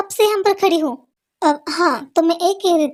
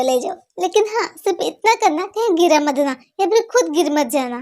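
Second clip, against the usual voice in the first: ordinary talk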